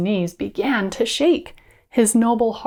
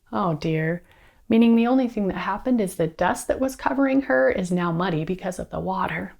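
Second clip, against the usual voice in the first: plain reading voice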